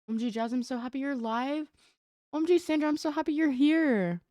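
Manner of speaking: high, gushing, happy welcome; gushing greeting. Real, not acted. Streamer greets viewers joining the live, gushing.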